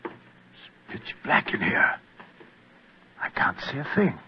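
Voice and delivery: whisper. Acted. Sneaking into dark cellar.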